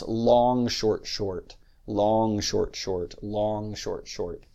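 "long, short, short" with weight on "long", falling away on each "short"; dactylic rhythm. Shown as a demonstration. Lecturer demonstrating the meter of the epics, dactylic rhythm. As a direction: count out "long, short, short" in threes. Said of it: "are written in dactylic meter".

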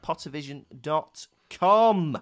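ordinary talk

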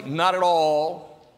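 ordinary talk

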